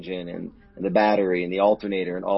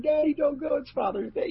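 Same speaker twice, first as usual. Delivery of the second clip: put-on much higher, childlike tearful plea; crying little girl. Little daughter begging dad not to leave, higher tearful plea. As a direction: beg like a small daughter on the verge of tears. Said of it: "Lauren, starts crying".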